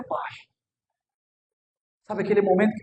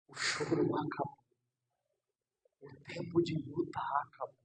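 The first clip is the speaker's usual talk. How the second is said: whispered prophecy; 9 semitones lower, 16 dB quieter than usual. Acted. Pastor whispering to the grieving congregation that it is over. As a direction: breathe the words like a secret.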